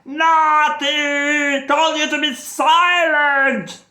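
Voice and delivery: high, loud telling-off; an angry grandpa scolding; mad grandpa. Acted. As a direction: scold her, cross and high. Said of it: "got mad".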